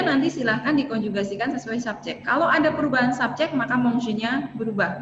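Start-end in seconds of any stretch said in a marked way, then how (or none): none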